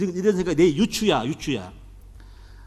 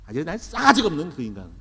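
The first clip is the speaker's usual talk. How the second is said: usual speaking voice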